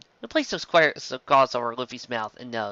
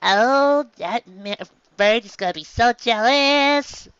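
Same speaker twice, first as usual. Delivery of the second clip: high, slow bird voice, gloating; high squawky bird, slowly savouring it; 10 semitones higher, 4 dB louder, much slower than usual. Acted. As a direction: crow it out like a smug mail bird.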